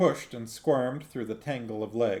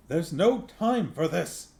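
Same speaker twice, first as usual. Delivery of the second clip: higher, louder impatient snarl; 7 semitones higher, 4 dB louder than usual. Acted. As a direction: snap it at them, out of patience. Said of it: "snarled Angua".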